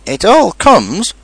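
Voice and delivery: high, slow, emphatic rebuke; stern. A narrator's reading. Rabbit correcting stuck Pooh, stern. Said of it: "said Rabbit sternly".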